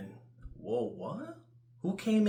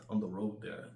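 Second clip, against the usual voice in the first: usual speaking voice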